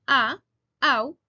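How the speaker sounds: "A, au" punched loud; teacher isolating "A, au", loud and emphatic; stressed first syllable. Shown as a demonstration. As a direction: hit the opening "A" and "au" hard to show the stress. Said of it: "la primera sílaba que comienza con".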